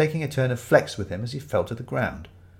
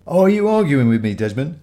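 loud, raised-pitch warning; confrontational challenge; 3 semitones higher, 8 dB louder than usual. Acted. Ramage challenges Desmond for refusing him, loud and threatening. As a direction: challenge him, then drop on the name. Customer threatens reluctant seller.

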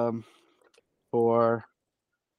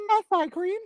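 much higher silly bard voice. Acted. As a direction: squeak it out as the dandy bard. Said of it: "let me get his voice".

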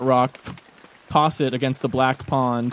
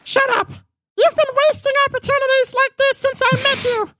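very high, furious bat shriek; angry squeaky bat. Acted. Bat yells at Clegg.

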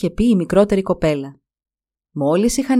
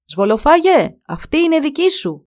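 raised voice calling to a friend; storybook call, lifted and louder; 7 semitones higher, 4 dB louder than usual. A narrator's reading. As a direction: call up to someone above. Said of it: "φώναξε".

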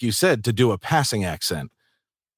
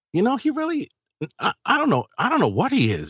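high, puzzled musing; puzzled inner thought; 13 semitones higher than usual. Retold or imitated. Narrator pondering the actor's ethnicity, high puzzled musing. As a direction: wonder aloud, baffled. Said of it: "I'm thinking to myself".

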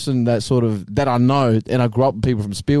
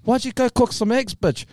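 Maori scaffolder griping, raised pitch; Maori accent; 8 semitones higher than usual. Shown as a demonstration. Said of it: "the other one is Modi".

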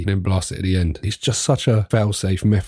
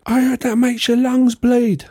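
much higher, louder prissy nagging voice; mocking listener; 4 dB louder than usual. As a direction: squeak it like a disapproving busybody. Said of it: "Somebody's listening now saying".

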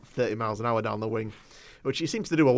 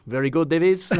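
laughing imitation of Bielsa's Argentine-accented English, higher and louder; 6 semitones higher, 8 dB louder than usual. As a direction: mimic Bielsa saying it, grinning. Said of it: "You gotta say it in his accent".

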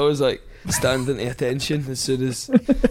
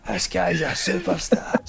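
slow, high-pitched mock shout at contained volume; imitated shouting; 5 semitones higher, slower than usual. Boss parading his labourer past tradesmen, slow mock shout. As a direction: draw it out like a boss announcing someone. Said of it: "he's shouting at all these guys going".

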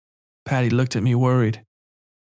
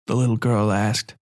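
ordinary delivery, nothing marked

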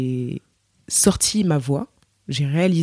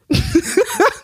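high-pitched burst of laughter. Real, not acted. Laughs at her own joke. (éclat de rire aigu)

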